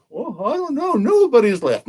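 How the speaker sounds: put-on high, lighter kid voice; high-pitched mock child's answer; imitating kids. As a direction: mimic a clueless child. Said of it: "Most kids just say".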